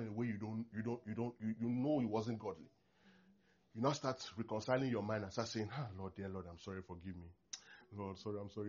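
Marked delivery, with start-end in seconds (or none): 5.7-8.7 soft, hushed mock remorseful prayer; mock tearful apology. Preacher imitating a wife apologising to God, hushed remorse. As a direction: murmur a contrite sorry to God.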